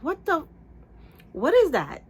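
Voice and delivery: aghast, reliving a nasty surprise; shocked reaction. Retold or imitated. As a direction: pitch leaps up in appalled disbelief. Retold shock at hair color.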